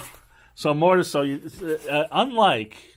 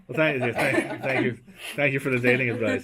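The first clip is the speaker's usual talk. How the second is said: sarcastic thanks through laughter; laughing thanks. Real, not acted. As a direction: laugh while thanking them mockingly. Mock thanks for dating advice.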